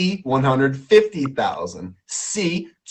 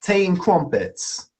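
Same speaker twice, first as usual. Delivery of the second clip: slow, put-on English accent; 3 semitones higher, slower than usual. Shown as a demonstration. Host showing guest his English accent. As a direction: attempt your best English accent, drawn out. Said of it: "I'll do an English accent".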